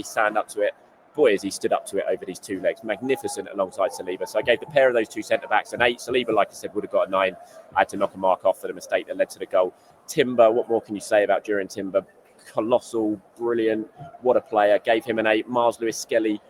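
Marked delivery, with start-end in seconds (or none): none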